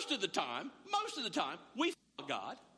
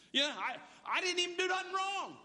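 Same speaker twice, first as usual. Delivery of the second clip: higher, whiny mock protest; 6 semitones higher than usual. Caleb whining to God that he did nothing wrong, higher and whiny. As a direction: whine it like a wronged kid.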